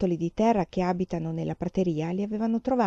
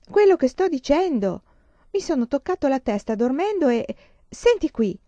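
raised, louder pitch; agitated insistence; 6 semitones higher, 5 dB louder than usual. A narrator's reading. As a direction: insist urgently on being believed. Husband insists to his wife. (insistendo, agitato)

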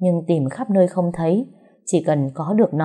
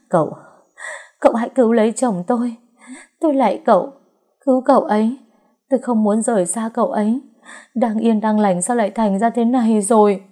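desperate begging; 5 semitones higher than usual. A narrator's reading. Wife begging the doctor to save her husband, frantic. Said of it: "van xin".

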